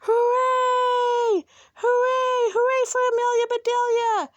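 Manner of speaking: high, loud, long hoorays; roaring cheer. A narrator's reading. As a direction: cheer like a whole team. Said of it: "she heard a loud roar".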